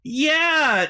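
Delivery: usual speaking voice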